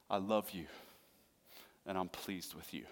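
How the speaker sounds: very soft and low; hushed, loving blessing, fading at the end; tender fatherly words. Acted. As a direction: say it softly, like a father to his son. The Father speaking to his Son.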